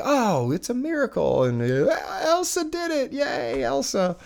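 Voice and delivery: high-pitched, hammed-up cheering; mock amazement. Family seeing rain stopped after show, mock amazement. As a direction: play at being awed by a miracle.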